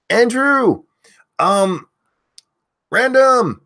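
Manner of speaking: loud, high name call-outs; yelling names. Real, not acted. Host yelling chat names to early viewers, loud and high. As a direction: belt each viewer's name out. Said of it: "if you want me to yell your name".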